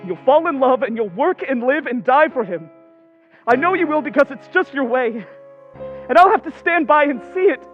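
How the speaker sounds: loud, building jealous tirade; bitter outburst. Acted. Rejected Laurie predicting Jo will love another man, bitter outburst.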